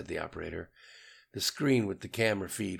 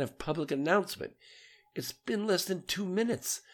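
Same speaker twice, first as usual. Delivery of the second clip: much higher, frantic disbelieving yell; yelling in disbelief; 9 semitones higher than usual. A narrator's reading. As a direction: shout the questions in alarmed shock. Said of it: "yelled Rocky".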